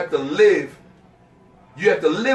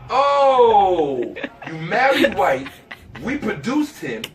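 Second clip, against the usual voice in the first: mocking retort; 3 semitones higher, slower than usual. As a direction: scoff at her through laughter. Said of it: "And I'm like".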